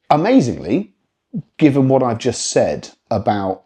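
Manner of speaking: usual speaking voice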